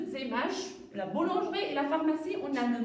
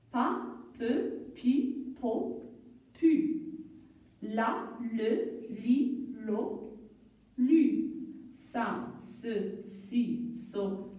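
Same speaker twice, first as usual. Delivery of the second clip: syllable drill; 3 semitones lower, much slower than usual. Shown as a demonstration. Teacher drilling consonant with vowel, spaced syllable reading. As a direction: read out each syllable alone, evenly spaced. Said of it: "la consonne avec la voyelle".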